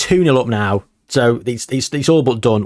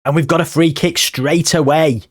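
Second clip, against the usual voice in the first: ordinary talk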